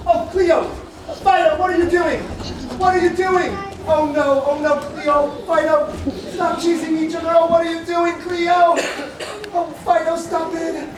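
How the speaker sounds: high, loud calling out; shouting. Acted. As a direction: yell at the pets to stop. Shouting at chasing pets.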